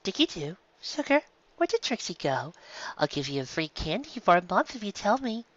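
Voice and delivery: high, soft sweet-talk voice; honeyed, coaxing high voice; sugary coaxing. Acted. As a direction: coax her sweetly, voice lifted. Sweet-talking a filly.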